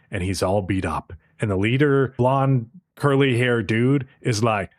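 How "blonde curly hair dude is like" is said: usual speaking voice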